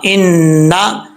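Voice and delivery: इन्ना with a long nasal n; measured ghunna. Shown as a demonstration. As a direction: hum the "न्न" of इन्ना before releasing. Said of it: "एक अलिफ की मकदार गुन्ना". (इन्ना में एक अलिफ जितना गुन्ना)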